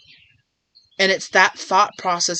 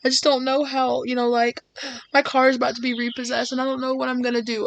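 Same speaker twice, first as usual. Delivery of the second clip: mock distressed plea; 6 semitones higher, faster than usual. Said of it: "you went to them crying".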